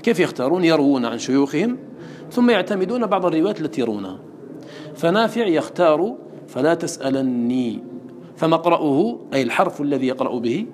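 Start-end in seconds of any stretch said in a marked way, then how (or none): 6.5-7.8 تسألني with a doubled n, tas'alanni; slow, hold the nun in تسألني; تسألني said tas'alanni with stressed double n. Shown as a demonstration. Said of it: "هو التشديد".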